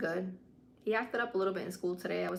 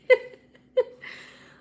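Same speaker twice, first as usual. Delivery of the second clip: laughing hard; loud high laugh; 12 dB louder than usual. Real, not acted. Laughing at the memory.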